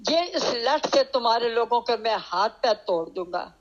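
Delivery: usual speaking voice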